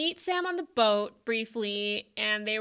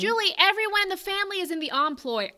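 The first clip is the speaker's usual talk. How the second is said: loud, high, rapid-fire protest; worked-up defence of a favourite; heated insistence; 9 semitones higher, 12 dB louder than usual. Real, not acted. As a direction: argue back fast and loud, pitch way up. Heated playful argument with co-host.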